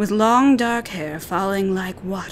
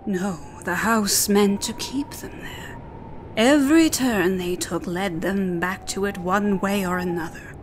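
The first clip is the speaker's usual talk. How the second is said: usual speaking voice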